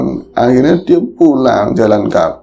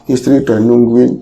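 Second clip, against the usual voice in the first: usual speaking voice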